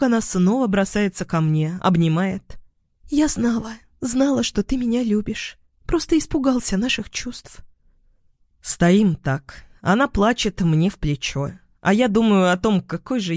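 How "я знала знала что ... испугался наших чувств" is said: put-on higher, softened girlish gushing; relieved lover. A narrator's reading. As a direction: pour it out in a high, fond voice. Relieved woman embraces him. (высоким голосом, с облегчением)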